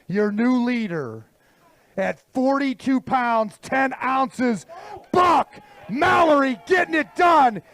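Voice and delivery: emcee working the crowd, punched names; event announcer. Acted. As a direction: announce the leader and sponsor to the crowd. Presents the new leader to crowd.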